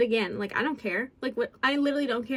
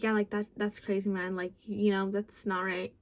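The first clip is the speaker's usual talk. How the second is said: lightly imitating boyfriend; 5 semitones lower, 6 dB quieter than usual. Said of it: "not doing it justice how I am repeating it".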